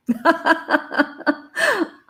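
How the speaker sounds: high, teary burst of laughter; emotional laugh. Real, not acted. Overwhelmed speaker laughs while tearing up. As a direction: laugh through welling tears. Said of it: "I'm welling up".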